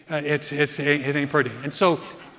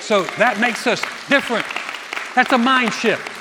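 raised, louder pulpit delivery; full-force preaching; 7 semitones higher, 11 dB louder than usual. Real, not acted. Preacher rallying the congregation against darkness, preaching at full force. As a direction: preach it high and loud.

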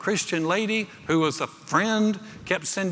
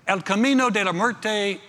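higher-pitched, showy Spanish accent; romantic Spanish; 5 semitones higher, 4 dB louder than usual. Shown as a demonstration. Preacher showing off his mocked Spanish accent, romantic Spanish flourish. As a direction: roll out the Spanish name with flourish. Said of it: "sounds romantic".

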